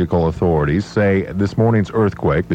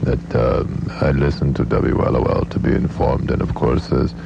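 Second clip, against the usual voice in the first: deadpan accented impression, pitched down in a monotone; Henry Kissinger; 2 semitones lower than usual. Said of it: "Henry Kissinger is with us".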